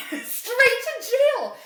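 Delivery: high, loud, drawn-out exclamation; slow high-pitched mock condemnation; mock outraged verdict. Real, not acted. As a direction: pass sentence slowly, way up high. Laughing, mock verdict on the story.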